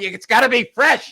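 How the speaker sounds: loud, high, emphatic demand; hammed-up indignant insistence; mock insistence. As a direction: holler it like a fussy Italian uncle. Acts out insistent uncle.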